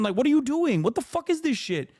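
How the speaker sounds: what is even happening, voice rising; outraged high-pitched disbelief; incredulous. Real, not acted. Exasperated disbelief at converts.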